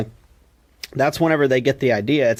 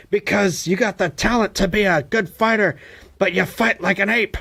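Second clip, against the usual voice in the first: higher mock Mickey laying it out; imitating Mickey, pitched up; gruff old trainer; 7 semitones higher than usual. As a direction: deliver the blunt truth like a crusty coach. Mickey's harsh verdict, retold.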